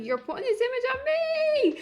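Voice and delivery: high-pitched mock wail, stretched out on the last word; mock crashing out. Reality show commentator imitating the bride crashing out, high mock wail. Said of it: "She's just crashing out".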